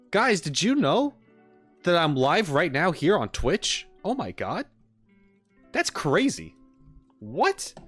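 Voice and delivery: hammed-up fake surprise, swooping high pitch; mock amazement. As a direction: act astonished by your own news. Sarcastic amazement at own notification.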